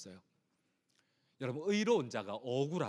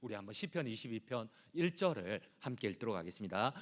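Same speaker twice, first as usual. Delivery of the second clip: ordinary delivery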